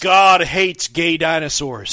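loud, slow fanatic proclamation; mock preacher. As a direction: preach it like a zealot. Mock fanatic sign slogan.

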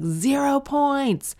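high, drawn-out mock announcement; mock score announcement. Comedian mimicking a zero score, mock announcement.

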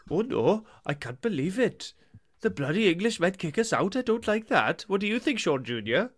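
soft, lilting mock Welsh; Welsh dad accent. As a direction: fret in a singsong Welsh accent. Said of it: "we'll do a Welsh one".

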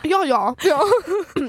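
mock posh "yah, yah". Teasing friend who sounded really posh, posh accent. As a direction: echo it in a hammed-up posh accent. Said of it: "that sounded really posh".

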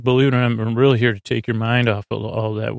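normal talking voice

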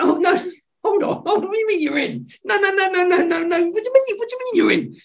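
high, laughing, can't-believe-it protest; startled disbelief. Retold or imitated. Telling a friend who said yes too fast, startled laughing disbelief. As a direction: backpedal in laughing alarm. Panics after friend instantly accepts.

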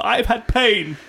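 a touch of grand suffering artist; mock tortured artist. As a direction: proclaim it like a martyr, half laughing. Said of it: "to give a loud".

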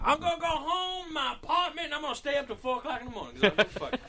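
put-on high-pitched rowdy old-woman caricature; imitated ferry lady. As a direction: ramble on in her high, put-on voice. Voices the woman announcing her plans.

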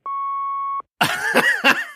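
shrieking high cackle. Real, not acted. Host cackling at a fart call, high shrieking laugh. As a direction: burst into a shrill cackle of laughter.

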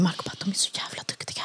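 hushed whisper into my ear; retold husband's impressed whisper. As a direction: whisper it so nobody hears. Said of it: "Viskar till mig".

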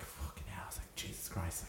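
breath-only swearing under her voice; retold whispered amazement. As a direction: whisper it to yourself, stunned. Retold private reaction to big spending.

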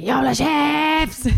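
long, high imitation scream; child's scream. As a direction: imitate the screaming kid, dragging out the last word.

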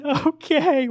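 put-on high little-kid okay; mock toddler. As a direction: squeak it like a small child. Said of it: "And they say".

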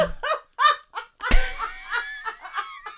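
long, high helpless laugh; prolonged fit of giggles; real laughter. Real, not acted. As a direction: laugh until it trails into wheezing. Laughing at own old joke.

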